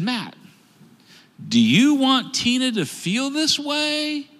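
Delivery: high, slow, gentle counselor voice; counselor's question. Retold or imitated. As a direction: ask it like a patient counselor. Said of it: "She said".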